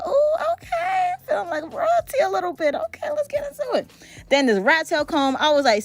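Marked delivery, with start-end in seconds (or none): 0.0-3.8 pitched-up mock royalty thrill; high delighted squeal. To viewers, reliving her reaction to the gold comb, high delighted gush.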